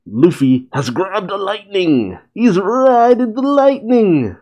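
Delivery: high, hyped-up battle cry; excited narration. A narrator's reading. Narrator relishing Luffy riding lightning, hyped up. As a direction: soar up high on the big moment.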